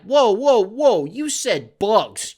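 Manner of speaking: a spooked exterminator backing away; alarmed exterminator. Retold or imitated. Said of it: "are they gonna be like".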